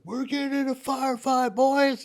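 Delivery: high, loud call into the vehicle; squad leader's shout. Retold or imitated. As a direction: yell it to the boys like a sergeant.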